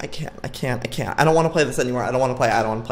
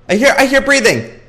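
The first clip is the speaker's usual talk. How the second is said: loud, high burst of alarm; alarmed outburst; 12 semitones higher, 9 dB louder than usual. Real, not acted. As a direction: blurt it out in a sudden scare. Startled by breathing in horror game.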